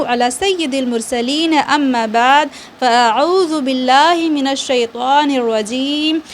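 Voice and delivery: measured, chant-like recitation of the opening prayer; Arabic recitation. Acted. Teacher opens the Qaida lesson with a recited prayer.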